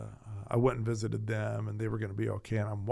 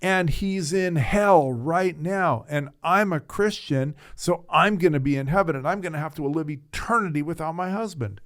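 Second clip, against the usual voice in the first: a dying widow despairing that she will spend eternity without her husband; anguished lament; 6 semitones higher, 6 dB louder than usual. Retold or imitated. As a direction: pour out grief about losing him forever. Then she started to cry.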